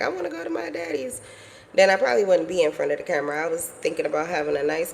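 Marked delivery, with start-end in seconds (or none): 0.0-1.2 put-on child's voice. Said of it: "if he wouldn't have been like".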